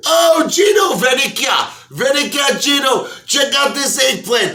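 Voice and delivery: high, loud mock Italian mobsters; laughing gangsters. Mafia guys mocking Pryor, loud high jeering. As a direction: call out like jeering wise guys. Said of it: "They're like".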